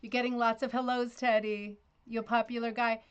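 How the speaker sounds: affectionate praise. Retold or imitated. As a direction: gush over him fondly. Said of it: "I would go".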